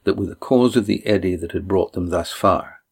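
ordinary delivery, nothing marked

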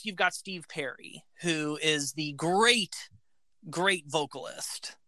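ordinary talk, nothing marked

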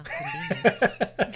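very high, very slow laughing "Convenient."; mock suspicious drawl; sarcastic. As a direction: drag it out, dripping with sarcasm.

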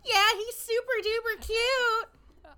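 very high, slow, loud gushing; high dreamy teen gush; Chelsea gushing. Retold or imitated. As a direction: swoon over the crush. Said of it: "Chelsea's like".

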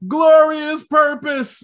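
high, drawn-out triumphant shout; imitated yell. Retelling Loki charging Alioth head first, imitated yell. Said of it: "and yells out".

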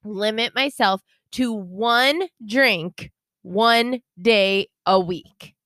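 measured, drawn-out word-by-word statement; slow, deliberate. Real, not acted. Telling co-host her one-drink limit, slow and deliberate. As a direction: spell out the limit slowly.

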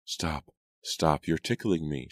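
ordinary delivery, nothing marked

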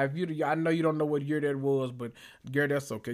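ordinary talk, nothing marked

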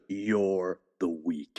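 hushed imitation of Jules; quoting Jules. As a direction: drop deep and slow for the quote. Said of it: "When he breaks out into".

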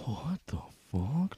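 slow, breathed whisper; awed whisper. Acted. Confused man hearing God, slow whisper. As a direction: whisper it in mock shock.

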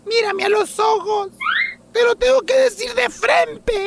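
high-pitched theatrical wife voice; insistent wife. Acted. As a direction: demand his attention as the dramatic wife. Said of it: "que ella dice". (esposa insistente, voz aguda y teatral)

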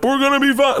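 very high, tight reassurance; strained forced calm. Retold or imitated. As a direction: pretend to be fine while panicking. Forced reassurance while panicking.

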